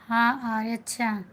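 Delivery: ordinary talk, nothing marked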